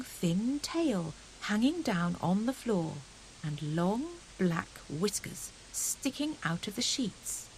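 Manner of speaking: plain reading voice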